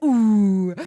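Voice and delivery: loud rising mock crowd ooh; teasing crowd reaction; crowd's ooh. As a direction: ooh like a whole audience. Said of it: "the whole crowd's like".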